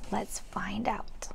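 slow unvoiced whisper; hushed suspense. Real, not acted. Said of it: "whispered reading aside".

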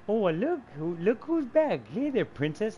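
high, lilting mock-sweet greeting; teasing mock greeting. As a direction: sing it out high, teasing a friend. Quotes Fish's teasing greeting.

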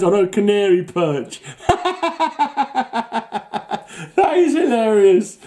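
real laughter breaking into high, loud speech; helpless giggles building as you talk; cracking up. Real, not acted. Host cracking up at Hawkman on a canary perch, laughing hard. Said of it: "I'm just cracking up".

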